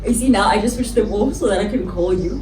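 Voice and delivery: ordinary delivery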